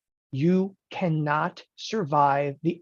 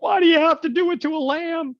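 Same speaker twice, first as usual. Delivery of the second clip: high, loud whiny pleading; mock animal lover; 11 semitones higher, 5 dB louder than usual. Animal lovers object to the lamb sacrifice, high mocking whine. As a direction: plead for the poor lamb in a put-on voice. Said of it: "they're like".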